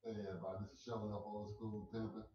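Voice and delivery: usual speaking voice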